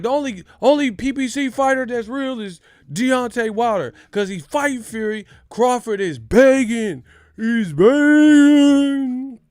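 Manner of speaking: very high, loud, ghostly whine; high wailing mock Bradley. Mocking Bradley's commentary, very high loud wail. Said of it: "He's like".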